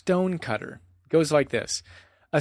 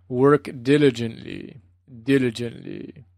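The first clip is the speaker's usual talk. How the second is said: Goenka's rhythmic Burmese voice. Retold or imitated. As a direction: intone it rhythmically in a Burmese accent. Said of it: "Goenka's rhythmic Burmese voice".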